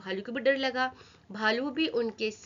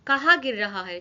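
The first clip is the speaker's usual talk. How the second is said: angry roar; 3 semitones lower, 5 dB louder than usual. A narrator's reading. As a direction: roar it at them in disbelief. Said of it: "गुस्से में दहाड़ कर कहा". (गुस्से में दहाड़ते शेर की आवाज़)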